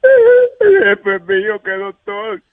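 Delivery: high, slow, crying wail; hammed-up wail. Caller telling the host about the doctor, in a hammed-up cry. Caller claims she will die.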